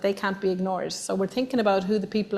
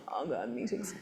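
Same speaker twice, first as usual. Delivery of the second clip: mock weary groan, much softer and lower; groan at meetings; 6 semitones lower, 13 dB quieter than usual. An introvert groaning at meeting invites, weary. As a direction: sigh it out like someone dreading meetings. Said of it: "the next person's gone".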